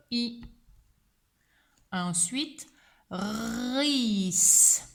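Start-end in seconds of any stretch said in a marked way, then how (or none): 3.1-4.8 "Ris" with long r and long final s; sounding out. Shown as a demonstration. Teacher dictates three sounds to pupil, "Ris" stretched slowly. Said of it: "Qui a trois sons".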